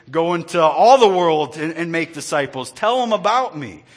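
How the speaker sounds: loud, rising call; building preaching shout. Acted. Preacher proclaiming the Great Commission, loud.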